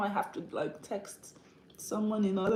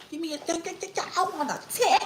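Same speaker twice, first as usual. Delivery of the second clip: high, hammed-up begging; mock demanding; 9 semitones higher, 5 dB louder, faster than usual. Streamer mocking those asking for too much, high mock begging. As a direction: rattle off a greedy demand in a high voice.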